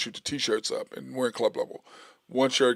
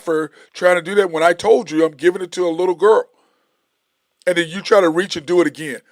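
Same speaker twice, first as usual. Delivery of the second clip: raised, higher, scolding shout; retold angry rant; 5 semitones higher, 8 dB louder than usual. Speaker scolds the older man over the little girl's shirt, loud and angry. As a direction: tear into the grown man who stole the shirt.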